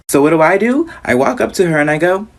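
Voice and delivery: usual speaking voice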